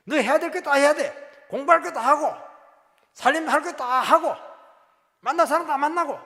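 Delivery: high, loud, insistent list of duties; stern loud command. Acted. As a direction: hammer each demand home. Spirit's demand voiced in sermon.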